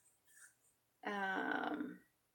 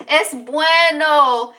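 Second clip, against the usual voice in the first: loud, high, drawn-out; playful mock-Spanish exclamation; 7 semitones higher, 13 dB louder, much slower than usual. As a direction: belt it out and stretch it, playfully. Playing at speaking Spanish.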